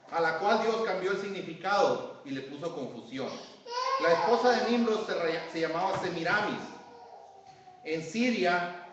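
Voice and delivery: loud, measured sermon reading; declaimed reading with deliberate pauses; reading aloud to congregation. Real, not acted. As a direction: read the notes out emphatically, phrase by phrase. Reads his notes aloud to congregation.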